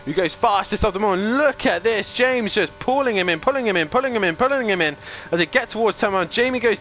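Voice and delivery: rising chant of pulling him in; excited commentary. Acted. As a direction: build the tension, repeating. Repeated chant calling closing gap.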